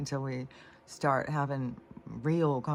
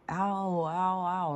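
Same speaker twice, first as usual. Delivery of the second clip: a higher, half-hearted mock "ow"; mock scream of pain; 6 semitones higher, slower than usual. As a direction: imitate a kid's yelp, not full out. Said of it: "screams out".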